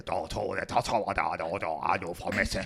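low fake Japanese gibberish; lightly mock Japanese babble; pseudo-Japanese. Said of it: "Pseudo-Japanisch".

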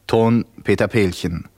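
ordinary talk

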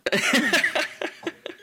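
high burst of laughter; a high real laugh at the joke. Real, not acted. Reacts to donut joke.

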